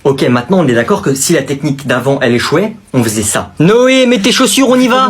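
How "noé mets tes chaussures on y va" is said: urgent call. Acted. Urging his friend to leave, loud and high. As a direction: hurry your friend out, raised and high.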